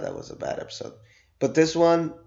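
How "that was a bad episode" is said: whispered aside. Real, not acted. Aside about the filler episode.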